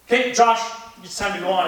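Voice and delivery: a stage manager calling out the cue; calling him on. Retold or imitated. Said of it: "and they went".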